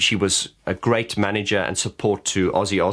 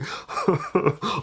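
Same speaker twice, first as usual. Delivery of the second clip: high giggle; 9 semitones higher than usual. Retold or imitated. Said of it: "they said".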